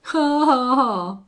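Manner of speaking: loud, high scornful laughter; bawled mocking laugh. A narrator's reading. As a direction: bellow the laugh in his face. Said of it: "bawled the rustler".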